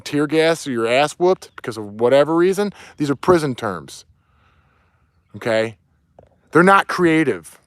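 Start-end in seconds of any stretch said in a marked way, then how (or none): none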